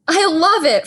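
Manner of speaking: loud, high, delighted hoot. Acted. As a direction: hoot it out with glee. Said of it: "Fred hooted".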